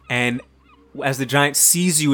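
ordinary talk

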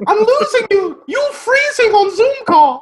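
put-on high, urgent kid's cry for Doctor Jones; Short Round voice. Sidekick yelling to Doctor Jones over Zoom, high mock call. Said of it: "It's like a meme".

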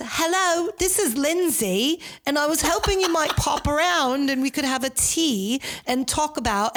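high, put-on British accent swinging Cockney to posh; high-pitched fake British accent, lurching between Cockney and posh; mock Cockney-posh accent. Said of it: "it went from Cockney to posh".

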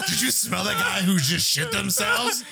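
laughing. Retold or imitated. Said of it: "he goes".

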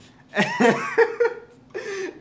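loud, high cackle of laughter; burst of laughter. Real, not acted. Recalling the Jar Jar prank to his friend, a loud high cackle. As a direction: crack up helplessly.

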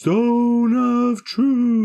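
high, slow, drawn-out incantation; dramatic spell call. Acted. Wizard calling out his spell to the table, high and slow. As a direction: ring out the spell name like a wizard.

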